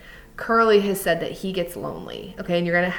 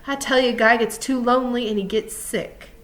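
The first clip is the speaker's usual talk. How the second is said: emphatic, higher-pitched insistence; crying out; 6 semitones higher, 5 dB louder than usual. A narrator's reading. Crooks telling Lenny about loneliness, crying out. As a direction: drive the point home, pitch up. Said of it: "he cried".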